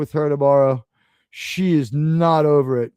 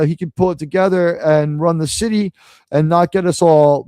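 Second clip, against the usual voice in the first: ordinary talk, nothing marked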